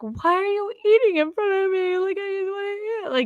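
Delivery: high, whiny mock-pleading voice; whiny complaint. Wife mocking her own whiny plea to husband, high and whiny. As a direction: whine it like a starving, put-out wife.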